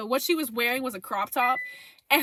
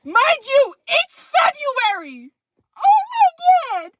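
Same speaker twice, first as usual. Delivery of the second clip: loud, high, drawn-out exclamation; exasperated disbelief; 10 semitones higher, 9 dB louder, slower than usual. Real, not acted. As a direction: cry out in real disbelief. Exclaims in shock opening the story.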